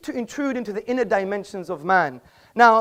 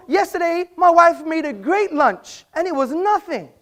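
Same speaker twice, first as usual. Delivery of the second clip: slow, raised, hammed-up praise of lunch; mock example; 7 semitones higher, 4 dB louder than usual. Mocking the idea of nothing to the audience, hammed-up sincerity. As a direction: sell the absurd line with mock sincerity. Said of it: "imagine if I said".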